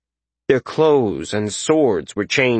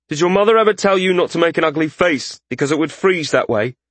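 higher-pitched figure posing a wry question; teasing commander; 7 semitones higher, faster than usual. A narrator's reading. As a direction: tease the soldiers in a commander's character voice.

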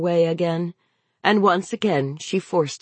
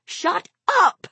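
high-pitched exasperated yell; frayed mother snapping at her child; angry yell; 12 semitones higher than usual. A narrator's reading. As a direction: yell it sharply, punching the last word. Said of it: "she yelled at him".

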